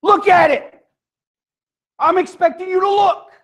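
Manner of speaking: high, emphatic parental order; insistent parent. Acted. Parent ordering the kids to look, insistent. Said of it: "Have you ever said that to your kids?".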